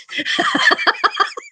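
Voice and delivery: high squeal of laughter; helpless high-pitched laugh. Real, not acted. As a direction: burst out in a shrieking laugh. Laughing at her own story.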